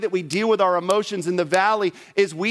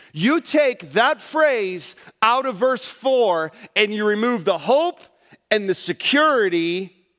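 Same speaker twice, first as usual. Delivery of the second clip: normal talking voice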